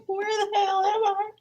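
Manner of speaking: high, cracking shriek of disbelief; stunned in a Beijing club bathroom; shocked disbelief. Retold or imitated. A speaker at a Beijing club toilet, high shocked cry. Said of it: "I was like".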